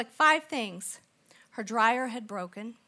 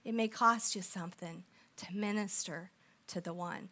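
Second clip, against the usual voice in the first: normal talking voice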